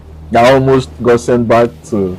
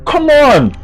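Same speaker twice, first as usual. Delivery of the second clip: exasperated; 13 semitones higher than usual. Real, not acted. Exasperated outburst retelling.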